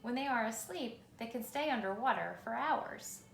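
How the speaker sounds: ordinary delivery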